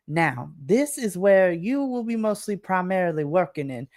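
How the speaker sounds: a syrupy Southern welcome, honey and darling; Southern lady. Acted. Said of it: "This is very Southern, like, lady".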